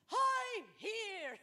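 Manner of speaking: put-on high cheeky kid voice. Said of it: "they were like".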